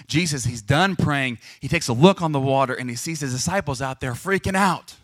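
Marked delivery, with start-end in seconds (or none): none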